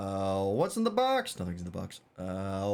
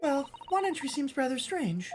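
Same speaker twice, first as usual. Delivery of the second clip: put-on much higher, polite young assistant; high girlish assistant voice. Assistant hoping the scent leads to the swindler, high and polite. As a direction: lift into a prim young lady's voice.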